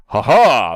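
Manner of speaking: a booming ha ha like a big paladin; hearty laugh. Retold or imitated. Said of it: "has him with a hearty laugh".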